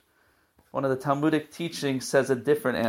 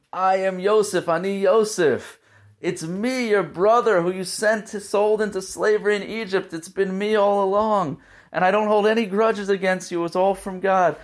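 higher, emotional reveal; 7 semitones higher than usual. Acted. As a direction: let it burst out as the long-hidden truth. Joseph reveals himself to his brothers.

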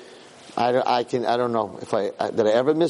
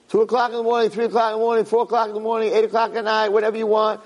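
raised, insistent listing at a steady high pitch; impassioned preaching; 8 semitones higher, faster than usual. Real, not acted. Rabbi inviting people to the Kaisel at any hour, impassioned preaching. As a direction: pile up the hours in a driving sermon rhythm.

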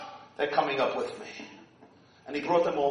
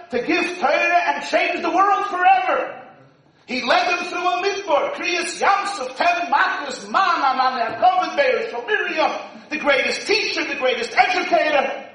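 rising, louder sermon build; preaching; 7 semitones higher, 4 dB louder than usual. Acted. Preacher building to a crescendo before the congregation. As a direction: preach it with mounting fervor.

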